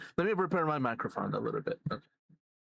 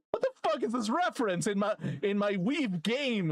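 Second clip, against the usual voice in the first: indignant outburst; 5 semitones higher than usual. Pretend. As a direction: wail the complaint in playful indignation. Repeats the rant, escalating.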